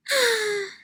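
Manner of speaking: long high-pitched mock sigh; a deflated sigh from mom. Mom sighs after scolding.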